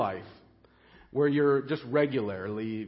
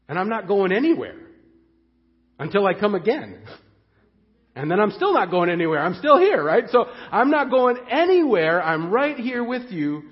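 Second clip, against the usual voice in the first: louder, higher, insisting he stays; emphatic promise; 4 semitones higher, 5 dB louder than usual. Acted. Jesus promising his disciples he stays, loud and fervent. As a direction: rise up to a passionate vow. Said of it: "Jesus says".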